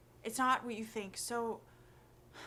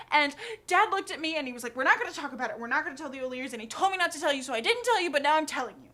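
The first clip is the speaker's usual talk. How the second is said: loud, raised, breathless-run confession; word vomit; 4 semitones higher, 9 dB louder, much faster than usual. Acted. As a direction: kid blurting out the whole secret to mom. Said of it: "word vomit. I tell her, like".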